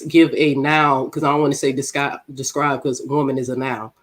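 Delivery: ordinary talk, nothing marked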